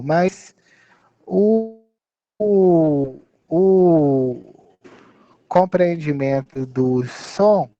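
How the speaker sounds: ordinary talk, nothing marked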